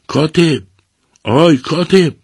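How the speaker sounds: loud, very high shout; calling out. A narrator's reading. Man below the roof hailing the scribe, shouting. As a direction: holler up to someone on a roof. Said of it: "کسی از پایین بام فریاد کشید".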